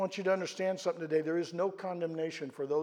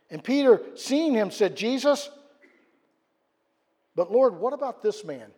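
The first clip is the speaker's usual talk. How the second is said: preached louder and higher; rising preaching; 5 semitones higher, 7 dB louder than usual. Acted. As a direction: lift the story to the whole church. Preaching, voicing Peter.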